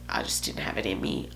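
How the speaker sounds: breathless, almost voiceless confession; near whisper. Real, not acted. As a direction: let it fall to a whisper, worn out. Confessing exhaustion, voice drops away.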